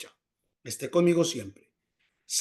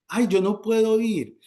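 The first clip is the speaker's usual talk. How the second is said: higher-pitched mock complaint; acted lament; 6 semitones higher, 4 dB louder than usual. Housebound believer lamenting to God, higher acted complaint.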